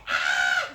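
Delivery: sudden high, loud "Ah!"; mock scream. YouTuber startled by a mystery noise, mock scream. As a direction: let out a playful high shriek. Mock fright after asking what that was.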